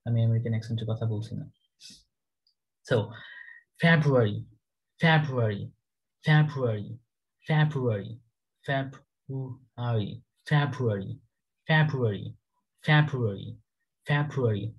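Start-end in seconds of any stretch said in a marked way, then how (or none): 3.8-14.7 February with the r barely heard, falling wave; British February. Shown as a demonstration. Teacher models February for Bangla learners, British accent. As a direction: say February without a rolled r, UK style. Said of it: "রর সাউন্ডটা পাবেন না UK অ্যাকসেন্টে".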